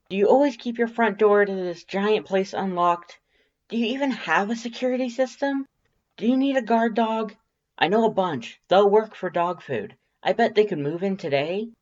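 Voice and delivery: high, loud, rapid-fire accusing questions. Acted. Undyne scolding her friend for the unlocked door, accusing and loud. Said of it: "Undyne says accusingly".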